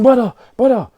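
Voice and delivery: high, urgent call of brother; urgent neighbour calling. Retold or imitated. Neighbour at the cell door, calling him urgently. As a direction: rouse him like a worried neighbour.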